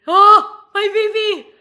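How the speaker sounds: high, very loud adoring squeal; over-the-top excited cooing; fangirl squeal. Pretend. Gushing over favourite wrestler.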